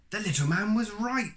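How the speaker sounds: high, bright-eyed realisation; excited. Acted. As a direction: Jack delighted he was right. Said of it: "thought Jack".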